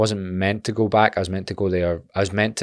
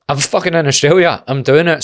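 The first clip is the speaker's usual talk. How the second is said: excited; 8 semitones higher, 7 dB louder than usual. Retold or imitated. Newcomer's excited inner voice.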